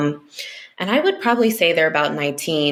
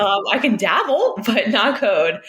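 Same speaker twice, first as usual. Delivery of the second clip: laughing; 5 semitones higher than usual. Real, not acted. Laughing about not coding.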